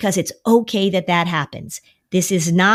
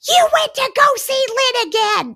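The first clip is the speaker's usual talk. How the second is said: put-on much higher accusing kid voice; imitating mad kid. Kid accusing parent after a session, in a high accusing child voice. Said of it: "the kid will be like".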